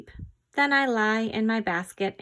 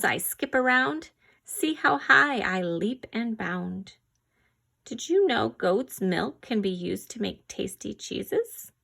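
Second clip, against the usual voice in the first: ordinary talk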